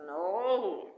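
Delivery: ordinary talk, nothing marked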